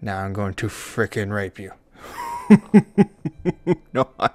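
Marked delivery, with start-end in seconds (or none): 2.0-3.9 loud, high giggling laugh; burst of laughter. Real, not acted. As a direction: laugh at your own bad joke. Laughs at his own joke.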